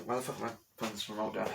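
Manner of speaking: ordinary talk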